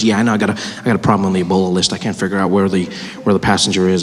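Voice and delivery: low official's reply; voiced safety official. Acted safety official answering air hostess.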